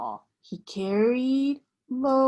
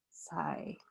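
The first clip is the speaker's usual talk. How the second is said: sigh; 15 dB quieter than usual. A narrator's reading. Truck sighs before sleep.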